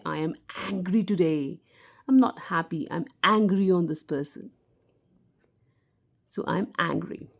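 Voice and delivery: ordinary delivery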